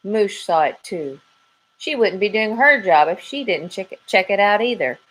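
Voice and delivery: plain reading voice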